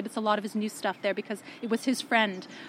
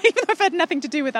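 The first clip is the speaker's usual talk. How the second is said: quick, higher, laughing self-mockery; 7 semitones higher, 4 dB louder, much faster than usual. Real, not acted. Guest laughs to the host about her undeserved pride in the yarn, laughing quickly. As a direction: laugh at yourself as you say it.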